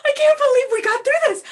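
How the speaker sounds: loud, high, laughing and half-crying with joy; overjoyed cry-laugh shout; ecstatic shouting. Acted. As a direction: shout it out in tearful euphoria. Celebrating after surviving the ordeal.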